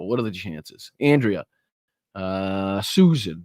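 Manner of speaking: usual speaking voice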